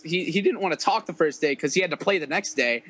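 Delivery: normal talking voice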